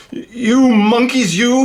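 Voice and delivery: high, slow scolding; scolding monkeys. Acted. Peddler scolding the monkeys in the tree, high slow. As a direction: wag a finger and scold. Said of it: "This made the peddler angry".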